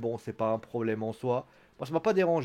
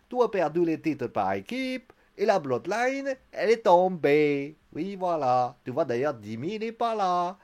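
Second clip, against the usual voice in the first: mock Swiss accent; 6 semitones higher than usual. Sami Zayn lecturing Jey Uso, in a botched Swiss-sounding accent. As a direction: stretch phrase endings in a silly Swiss accent. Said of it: "pourquoi je fais l'accent?".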